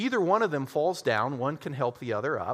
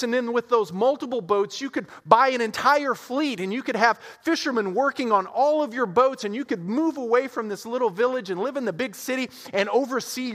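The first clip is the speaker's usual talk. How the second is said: higher, eager sales pitch; pushy businessman; 6 semitones higher, 3 dB louder than usual. Acted. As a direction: build the pitch like a slick CEO. Voiced businessman lecturing the fisherman.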